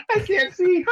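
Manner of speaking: put-on very high, loud kid voice through giggles; giggly toddler voice. Dad imitating his toddler's playful fake blindness, very high giggly voice. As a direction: squeal it like a toddler in on the joke. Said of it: "he just starts laughing".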